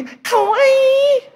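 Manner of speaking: very high-pitched squeal of delight; mock gushing Japanese squeal; cooing shop worker. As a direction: squeal it long and high over a cute baby. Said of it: "ada orang toko gini".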